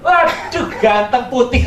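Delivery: put-on high, loud gushing over a cute baby; delighted gasp. Pharaoh's daughter gushing over the baby, high and loud.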